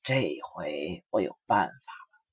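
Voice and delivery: low, soft and slow sly wolf; big bad wolf thinks up a trick, smug and hushed; smug wolf thought. A narrator's reading. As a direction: gloat quietly to yourself. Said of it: "他得意地想".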